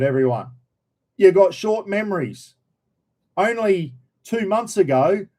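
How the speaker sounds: normal talking voice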